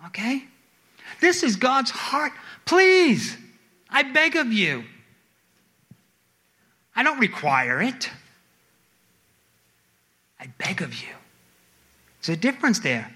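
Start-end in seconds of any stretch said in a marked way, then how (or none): 2.6-4.8 high, heartfelt begging; pleading. Acted. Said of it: "We beg and plead God". 10.4-11.1 whispered plea; begging. Acted. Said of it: "God is begging us".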